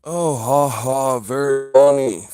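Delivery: loud sarcastic "ha, ha, very funny"; annoyed laugh. Real, not acted. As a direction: scoff it out, loud and slow. Sarcastic reply to chat trolling.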